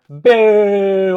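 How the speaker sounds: loud booing. Real, not acted. Fan booing the squash match, loud boo. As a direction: boo it like a crowd member. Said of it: "It was a bummer".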